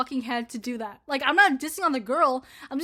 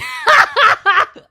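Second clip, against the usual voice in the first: a sudden shrieking laugh, much higher and louder; loud high laugh burst; 13 semitones higher, 16 dB louder than usual. Real, not acted. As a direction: let a real laugh explode out.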